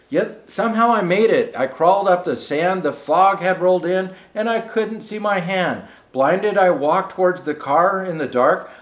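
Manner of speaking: measured, rhythmic storybook reading; lilting holiday verse reading; poem recitation. Acted. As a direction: read it like a bedtime Christmas rhyme. Rhymed Christmas poem read aloud.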